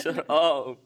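amused, playful comeback; laughing retort. Real, not acted. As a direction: brush off the teasing through laughter. Laughing back at co-host's teasing.

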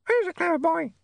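very high, squawky mimic voice; talking animal. A narrator's reading. Painted parrot echoes Saccharissa, very high animal voice. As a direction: parrot the phrase like a pet. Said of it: "said the dog".

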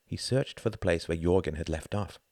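plain reading voice

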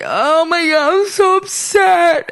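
high, slow tearful wail; mock crying. Sick woman retelling her meltdown, mock crying. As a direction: wail it like a hammed-up cry. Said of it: "I was, like, crying".

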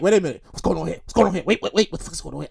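low, hushed, worried mutter trailing to almost nothing; nervous muttering. Retold or imitated. Man seeing a suit walk in, muttering low in alarm. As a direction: whisper your alarm to yourself. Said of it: "I, I'm nervous".

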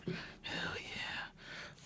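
breathy unvoiced cheer; whispered hell yeah. Real, not acted. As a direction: whisper it triumphantly. Whispered celebration.

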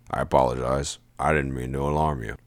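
low, indifferent apology; unapologetic. A narrator's reading. Sajin apologizing to startled narrator, not sorry. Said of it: "tone that didn't sound the least bit".

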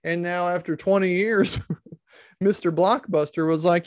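ordinary delivery

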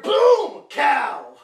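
sudden loud, high shout on boom and cow; jump scare shout. Retold or imitated. Telling friends about the cow jump scare, sudden high shout. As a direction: blast it out like the scare just hit.

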